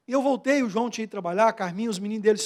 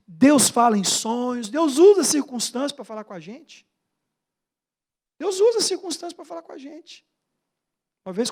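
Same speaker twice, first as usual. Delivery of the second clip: ordinary delivery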